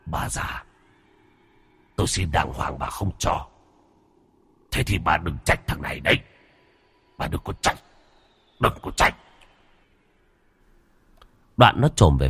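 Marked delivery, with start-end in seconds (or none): 0.0-9.2 low hissed threat through clenched teeth; menacing angry threat. A narrator's reading. Sinh threatens old woman who refused him, hissed menace. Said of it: "sắc mặt trở nên vặn vẹo tức giận. Nó nghiến răng kèn kẹt".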